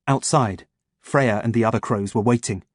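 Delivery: ordinary delivery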